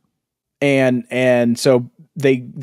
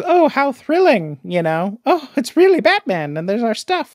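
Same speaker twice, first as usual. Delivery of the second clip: prissy delighted guests; 10 semitones higher than usual. Retold or imitated. Mocking the guests who adore Batman, high gushing voice. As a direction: swoon over Batman like a posh guest.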